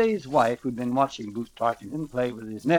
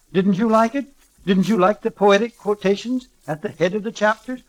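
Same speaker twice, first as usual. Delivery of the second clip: high, loud insistent questioning; indignant rising questions; demanding; 6 semitones higher, 5 dB louder than usual. A narrator's reading. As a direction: press for an answer, louder and higher. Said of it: "she demanded".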